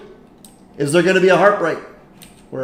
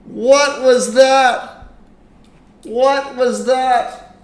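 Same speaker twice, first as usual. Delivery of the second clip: stunned disbelief; 5 semitones higher, much slower than usual. Real, not acted. Marble falls off at the end.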